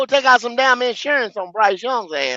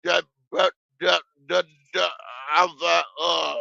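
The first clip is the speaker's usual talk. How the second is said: soft, low mock of a team boss; mocking Dan Morgan; 7 semitones lower, 7 dB quieter than usual. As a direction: mumble the empty promise, low. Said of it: "That was Dan Morgan".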